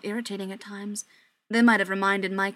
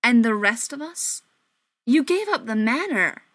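louder, urgent outburst; exclaiming; 2 semitones higher, 7 dB louder than usual. Acted. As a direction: exclaim it in disbelief. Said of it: "Mike exclaimed".

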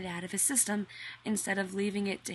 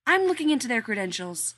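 louder, higher huff; indignant; 4 semitones higher, 7 dB louder than usual. Acted. Rodney fuming to John about the workmen, louder. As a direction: fume about them.

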